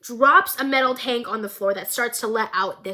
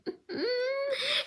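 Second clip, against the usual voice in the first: manic giggle; 14 semitones higher, 8 dB quieter than usual. Retold or imitated.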